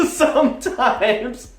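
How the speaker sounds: a real laugh spilling into slow words; laugh, then ease through slowly. Real, not acted. Vlogger to viewers, laughing through the lesson.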